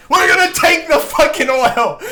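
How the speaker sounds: mocking Trump's boast as a shout; Trump impression. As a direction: bellow it like a brash rally speaker. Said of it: "he's like".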